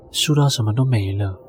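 usual speaking voice

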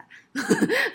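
高亢一笑; 忍不住笑出来; high burst of laughter. Real, not acted. 讲到自己大吼后笑. (高声笑出来)

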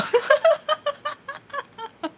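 long high burst of laughter that falls away; real loud laugh. Real, not acted. Host laughing at how absurd her own story was, loud high laugh.

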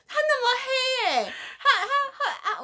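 high, slow, fumbling mock fear with a laugh; imitating scared mom. Mom explaining her fear to daughter, mock-scared stammer. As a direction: stammer like a frightened mother. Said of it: "他说".

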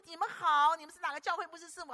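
loud, fast, eager hello; eager greeting. Retold or imitated. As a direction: greet them with a burst of friendliness. Greets couple warmly.

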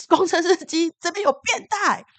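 loud high yell to the bus driver; alarmed shout of pervert on the bus. Retold or imitated. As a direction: call out loudly so the whole bus hears. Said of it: "我就大骂".

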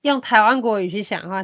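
ordinary talk, nothing marked

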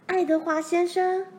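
high, loud excited guess; excited cry. A narrator's reading. Laura shouts her guess to Pa, high and loud. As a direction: blurt the name out with joy. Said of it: "罗兰大声说出来".

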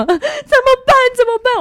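high, loud mock panic; hammed-up anxious cry; imitated frantic mother. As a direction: repeat it like a mum losing her head. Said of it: "我妈就一直讲很紧张".

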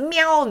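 a high, mock cat's meow; imitated cat meow. Imitating the cat meowing back at her name. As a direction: call out like the cat answering. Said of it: "他也马上大喊一声".

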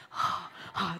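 airless, voiceless gasping; breathless gasps. Retold or imitated. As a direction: gasp like a patient who can't breathe. Imitating breathless intubated patients. (无声地喘气)

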